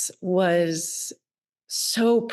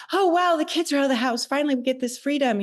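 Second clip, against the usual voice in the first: higher, fast mock-excited quote of other people; mock cheerful; 7 semitones higher, much faster than usual. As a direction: chirp it like a breezy cliché about freedom. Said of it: "There was always like".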